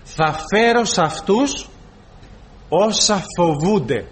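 slow, weighty, stressing φέρω and όσα; slow solemn stress. Shown as a demonstration. Preacher to the congregation, slow and solemn, stressing όσα. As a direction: draw out each word of Θα φέρω σ' αυτούς όσα φοβούνται. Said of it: "Εδώ είναι ένα φοβερό, αδελφοί, μια φοβερή αλήθεια".

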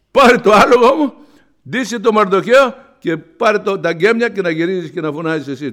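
loud, high royal order; king ordering Haman, loud command. Acted. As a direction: hand him the task like a decree.

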